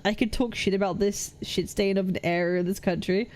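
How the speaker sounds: usual speaking voice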